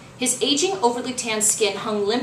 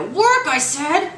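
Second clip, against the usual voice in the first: slow, high, loud command; furious shout; 8 semitones higher, 6 dB louder, much slower than usual. Acted. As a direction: demand it furiously. Jabbing useless remote, furious.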